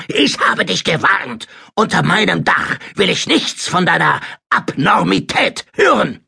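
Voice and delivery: high, outraged tirade; wütend brüllend. A narrator's reading. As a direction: lay down the law in a rage. Roaring uncle threatens his nephew. (aufgebrachtes, hohes Wüten)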